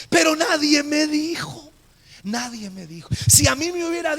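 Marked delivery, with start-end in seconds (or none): 0.1-1.7 high, loud lament to the congregation; emphatic declaration. Real, not acted. Pastor tells congregation nobody warned him, emphatic. Stressed statement, then repeated low. 2.2-3.1 soft, dropped-down echo; subdued repetition. Real, not acted. Pastor repeats to himself that nobody warned him, subdued. Same statement repeated low.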